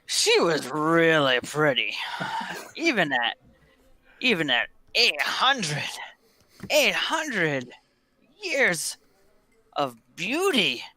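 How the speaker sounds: halting, savoring reminiscence with swooping emphasis; tipsy old regular lost in fond memories; wistful old drinker. Acted. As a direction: admire her in drawn-out, broken phrases. Old Flippin reminisces about the master.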